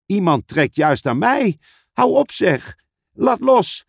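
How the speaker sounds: high-pitched, agitated protest; alarmed call. Acted. Suze being pulled by someone unseen, calling out in alarm. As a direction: cry out to be let go. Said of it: "riep Suze".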